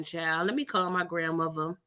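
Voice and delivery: ordinary talk, nothing marked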